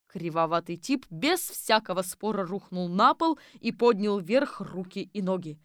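plain reading voice

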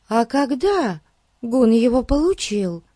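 put-on high-pitched little girl voice; Daisy. A narrator's reading. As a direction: ask curiously in a high girlish voice. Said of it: "поинтересовалась Дейзи".

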